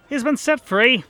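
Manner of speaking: high, loud blurt of bad news; urgent announcement. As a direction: burst in with it breathless. Beryl bursts in with news.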